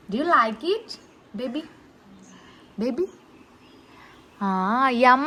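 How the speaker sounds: ordinary talk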